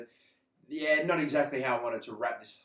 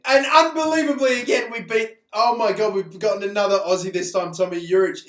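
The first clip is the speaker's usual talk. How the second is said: higher and much louder, building joy; excited; 4 semitones higher, 7 dB louder than usual. Real, not acted. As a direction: burst out with delight at the pull. Another rare card pulled.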